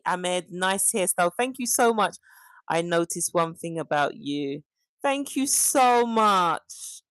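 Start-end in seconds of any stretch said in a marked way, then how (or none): 5.0-7.0 slow, stretched thanks leaning on so; drawn-out thanks. Real, not acted. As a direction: draw every word out slowly, savouring the compliment. Thanking viewer for compliment.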